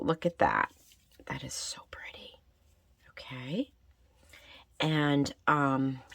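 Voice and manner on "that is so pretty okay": whispering. Real, not acted. Quietly admiring her piece.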